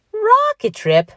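very high, loud, scoffing question; incredulous echo. A narrator's reading. Patrick scoffs at Mickey's rocket plan, incredulous echo. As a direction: repeat it as if it were absurd.

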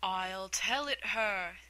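slow, hollow turtle voice; deep hollow tone. Acted. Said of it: "in a deep, hollow tone".

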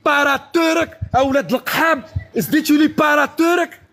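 high-pitched jeering to the crowd; mocking call, pitched well up; taunting. Real, not acted. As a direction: crow it high, drawing out the words. Crowing to audience over rival who left.

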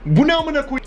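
sudden high disbelieving cry; shocked outburst. Real, not acted. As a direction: blurt it out in shock. Shocked at options list.

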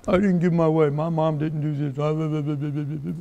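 mock sulking child, slowed and sunk down; pouting child. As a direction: mimic a kid sulking after not getting their way. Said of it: "They'll go pout".

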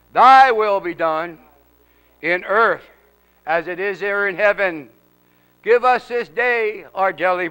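slow, drawn-out Lord's Prayer with pauses; leading prayer. Acted. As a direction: recite phrase by phrase for others to follow.

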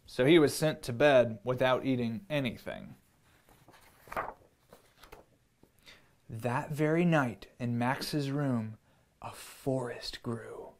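put-on gentle storybook read-aloud for kids; reading to children. A narrator's reading. Parent reading a picture book to children, gentle storyteller voice. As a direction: tell it like a bedtime story.

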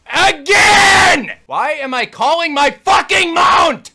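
high, loud frustrated shouting; angry yelling. Real, not acted. Player yells at the boss and his own character. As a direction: hurl every word at the screen.

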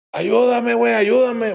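high, sustained plea; imitating an injured friend calling; acted cry for help. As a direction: call out for rescue, pitched high.

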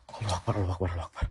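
soft, low, murmured takbir; murmured prayer in the dark; hushed prayer. Real, not acted. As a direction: pray quietly to calm your fear. Praying after the scare.

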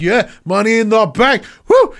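imitating a cocky, pumped-up wrestler; hyped shout. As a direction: get all humped up for the match. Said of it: "he's like".

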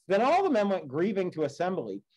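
steady, measured read-aloud of an epic; story narration. A narrator's reading. Teacher reading the Odyssey to the class, measured storytelling.

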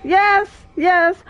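high, punched cheers; triumphant. Real, not acted. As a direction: cheer each one separately, elated. Celebrates beating the boss.

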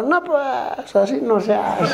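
high, whiny reproof; mock scolding. Husband scolds his friend for frightening child, high mock reproach. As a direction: scold in a high comic whine.

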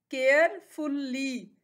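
Carefully slowly as care, फुल, ली; split into syllables. Shown as a demonstration. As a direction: stretch carefully into three beats. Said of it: "इसके three syllables हैं". (Carefully को तीन हिस्सों में, धीरे)

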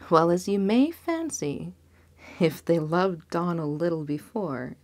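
usual speaking voice

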